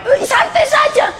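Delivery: sudden call to relax. Real, not acted. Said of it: "calling out to slow down".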